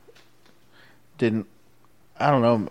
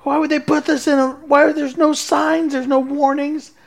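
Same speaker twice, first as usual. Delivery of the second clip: squeaky, much higher panicked cursing, slowing at the end; mock panicked cussing; 4 dB louder than usual. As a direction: replay the freak-out with a laugh in it.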